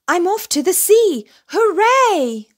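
high, loud joyful whoop; excited cheer. A narrator's reading. Little bear cheering as he leaves for the sea, high and loud. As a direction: cheer like a thrilled cub.

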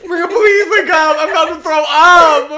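high, shouted mock desperation; begging. Begging a sleeping friend to wake up, shouted pleading. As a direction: beg at full volume, rising into a yell. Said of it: "I was literally begging".

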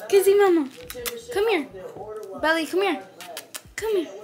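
high, eager coaxing of a dog; pet-talk voice. Real, not acted. Owner asks Chanel to go bye-byes, high coaxing. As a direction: call the dog over in a high voice.

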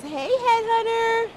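high, slow, sung-out hello to a viewer; excited greeting. Real, not acted. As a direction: call out the name with delight. Greeting a chatter.

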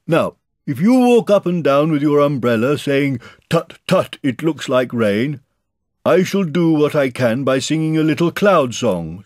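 high, loud bear voice; Pooh directing earnestly. A narrator's reading. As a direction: give the plan, building to a shouted order.

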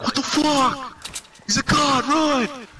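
high panicked squeal; panicked enemy. Retold or imitated. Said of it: "they're just gonna be like".

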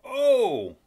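loud high gasp of disbelief; a sudden loud oh of outrage; shocked oh. Real, not acted. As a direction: burst out oh in disbelief. Laughing outburst at the clip.

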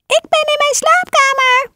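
put-on wolf squeaking like a sweet old lady; wolf faking grandma's voice; squeaky fake granny. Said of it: "met een piepende stem".

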